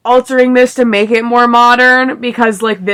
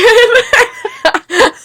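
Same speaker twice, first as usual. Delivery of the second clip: a quick high giggle; 13 semitones higher than usual. Real, not acted. Giggles at the place names.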